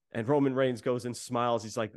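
usual speaking voice

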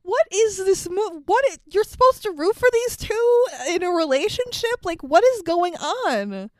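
high, baffled ranting; exasperated disbelief. Real, not acted. Host asked to root for this couple, high exasperated rant. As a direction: demand sense of it, pitched well up.

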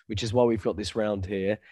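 usual speaking voice

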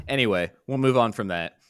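ordinary talk

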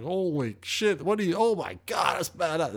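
high, slow astonished praise; drawn-out high excited wonder; amazed crowd. Retold or imitated. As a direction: gush like awed onlookers. Crowd amazed by his bass jam.

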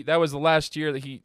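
ordinary talk, nothing marked

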